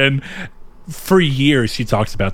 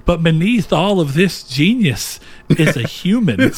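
quoting the character; 6 semitones higher, slower than usual. Retold or imitated. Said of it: "he's like".

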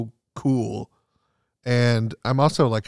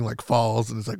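ordinary delivery

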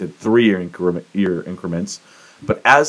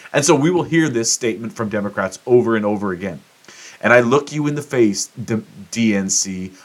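ordinary talk

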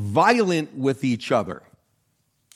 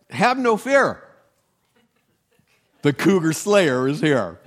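lightly hammed-up hero announcement, higher pitched; mock-heroic boast; 4 semitones higher, 4 dB louder than usual. Hiker boasting about the little knife, mock-heroic. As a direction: proclaim it like a mock hero, pausing midway.

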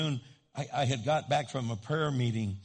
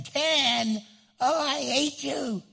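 high, loud mock-teen whine; hammed-up teenage tantrum; outraged teenager; 10 semitones higher, 5 dB louder, much slower than usual. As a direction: play an indignant teen protesting curfew, drawn out. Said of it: "your daughter or son is, like, thirteen to fifteen".

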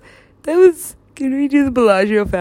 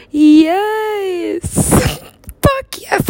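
high, drawn-out cry of victory; triumphant elation; 7 semitones higher, 4 dB louder, much slower than usual. Retold or imitated. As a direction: squeal it in triumph. Acting out reaching heaven.

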